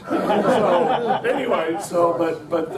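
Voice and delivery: laughing loudly through the words. Real, not acted. Speaker laughing off his own joke, laughing loudly. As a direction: laugh it off and move on.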